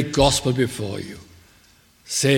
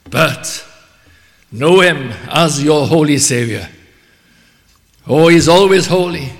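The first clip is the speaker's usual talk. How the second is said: loud, raised fervent exhortation; loud preaching; 5 semitones higher, 8 dB louder than usual. Real, not acted. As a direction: proclaim it with full force. Preacher exhorting at full force.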